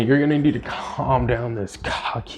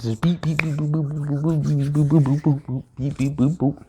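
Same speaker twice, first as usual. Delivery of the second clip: quick mock beeps and boops, higher; beep boop fight noises; 3 semitones higher than usual. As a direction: rattle off cartoon fight sounds. Imitating fight sounds.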